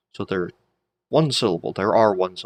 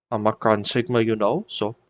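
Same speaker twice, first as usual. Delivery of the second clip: ordinary talk, nothing marked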